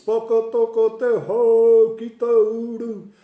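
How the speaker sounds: loud, slow, high declaiming of a Māori speech; mock formal speech. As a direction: proclaim it like a grand orator. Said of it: "this so-called speech".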